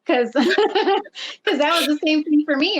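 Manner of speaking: laughing. Real, not acted. Laughing while agreeing.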